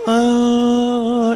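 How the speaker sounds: long, drawn-out moan; mock pleasure moan. Woman in her perfect bath, mock blissful moan.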